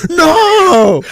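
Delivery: high, hammed-up wail of refusal; mock anguished scream. Actress blackmailed by the director, mock anguished scream. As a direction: scream it like a melodramatic victim.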